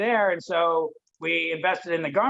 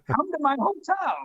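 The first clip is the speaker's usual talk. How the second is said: excited; 9 semitones higher than usual. Retold or imitated.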